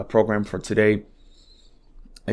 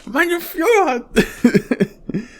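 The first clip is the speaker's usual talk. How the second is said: high, squealing mock fanboy cry, slow on the second; mocking Musk; much slower than usual. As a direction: ham up a giddy, drugged-out Musk. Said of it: "it's like".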